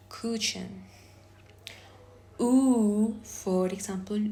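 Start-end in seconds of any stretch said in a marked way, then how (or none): 2.4-3.2 U held long, loud and higher; isolated vowel sound. Shown as a demonstration. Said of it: "I am going to pronounce".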